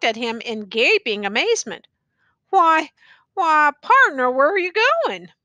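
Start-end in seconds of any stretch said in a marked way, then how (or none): 2.5-5.3 higher, louder, faltering question; unsteady. A narrator's reading. Tattered man asks the leaving youth, unsteady and faltering. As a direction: shaken and unsure, reaching out. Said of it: "he asked unsteadily".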